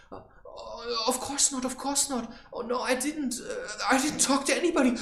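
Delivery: high, loud, flustered fake innocence; mock panicked denial. Man caught by his woman, hammed-up panicky denial. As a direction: squeal out a guilty denial.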